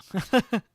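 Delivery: loud high burst of laughter. Real, not acted. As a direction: crack up loudly. Reacting to chat joke.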